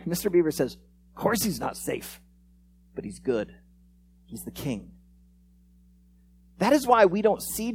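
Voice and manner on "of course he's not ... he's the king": slow, hushed, low assurance; gently solemn storyteller's quote; quiet reassurance. Acted. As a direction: let it sink lower and softer, with pauses. Voicing Mister Beaver reassuring Lucy.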